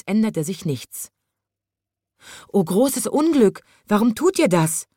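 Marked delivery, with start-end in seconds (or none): 2.5-4.9 higher, despairing lament; verzweifelt. A narrator's reading. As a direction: plead with the animals in despair. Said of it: "fragte der kleine Zoowärter verzweifelt". (verzweifelt klagend, mit höherer Stimme)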